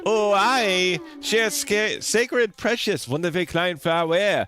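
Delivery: dramatic mock-French lament; French accent. Reading a game character's lament about a ruined flower, French accent. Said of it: "it's my best Frenchie accent I got".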